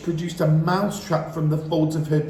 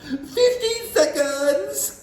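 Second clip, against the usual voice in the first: witch crying out; 14 semitones higher, 3 dB louder, much slower than usual. A narrator's reading. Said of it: "cried the Grand High Witch".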